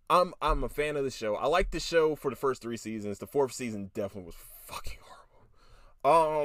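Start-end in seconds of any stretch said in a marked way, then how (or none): 3.2-5.0 fast conspiratorial whisper; hushed and rushed before the punchline. Real, not acted. Building to a verdict. 6.0-6.4 loud verdict. Real, not acted. Verdict after whisper.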